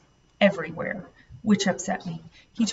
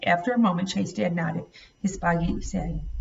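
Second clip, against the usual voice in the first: ordinary delivery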